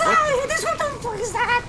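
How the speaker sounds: very high panicked yelp; high, squealing alarm; freaked-out squeal. Real, not acted. Freaking out at the game.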